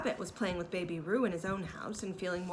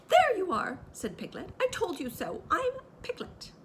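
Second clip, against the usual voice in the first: much higher, loud I told you so; triumphant Piglet; 11 semitones higher, 4 dB louder than usual. A narrator's reading. Piglet triumphant to Kanga, much higher. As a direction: crow it, vindicated.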